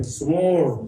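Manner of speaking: 스몰 with a long, stretched second syllable; drawn-out pronunciation. Shown as a demonstration. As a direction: model 스몰 slowly for repetition. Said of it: "이렇게 해봐요". ('스몰'을 길게 늘여 발음)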